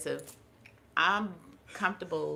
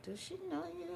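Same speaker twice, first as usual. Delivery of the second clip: puzzled sister; 14 semitones higher, 6 dB quieter, faster than usual. Retold or imitated. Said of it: "my sister was like".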